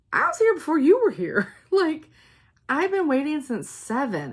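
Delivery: loud, high indignant insistence; standing her ground, loud and high; indignant retort. Retold or imitated. Retold line dispute with volunteer.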